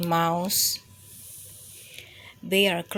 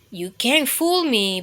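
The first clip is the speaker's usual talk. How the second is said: ordinary delivery, nothing marked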